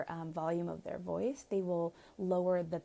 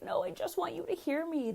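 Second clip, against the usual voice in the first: pleading to be heard; 10 semitones higher than usual. Retold or imitated. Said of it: "you're like".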